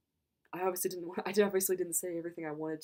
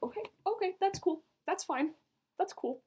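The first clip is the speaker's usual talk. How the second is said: high, loud, overexcited acting casual; flustered agreement; 13 semitones higher, 4 dB louder than usual. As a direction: squeak it out while pretending it's fine. Retelling her flustered agreement.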